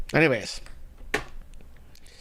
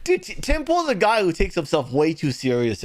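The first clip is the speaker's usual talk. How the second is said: ordinary delivery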